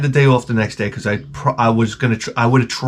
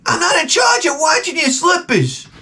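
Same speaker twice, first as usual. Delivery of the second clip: shrill yelling wife. Retold or imitated. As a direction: yell it back like the wife next door. Neighbor wife yelling back.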